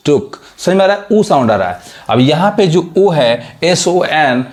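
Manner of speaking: normal talking voice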